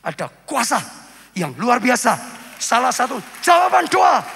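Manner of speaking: forceful preaching, building to a high shout; shouted proclamation. Real, not acted. Preacher proclaims God's power to congregation, rising to a shout. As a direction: build to a ringing, full-volume declaration.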